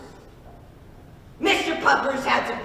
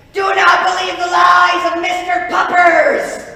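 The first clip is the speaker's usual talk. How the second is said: booming decree; 7 semitones higher, 10 dB louder than usual. Acted.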